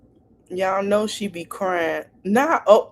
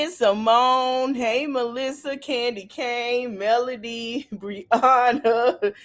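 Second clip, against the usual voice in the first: ordinary delivery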